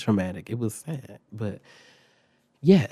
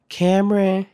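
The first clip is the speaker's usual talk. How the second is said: loud, higher name call; 7 semitones higher, 6 dB louder than usual. Retold or imitated. Assistant principal summoning a suspect from class, calling out. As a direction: call the pupil out before the class. Official calls out a student's name.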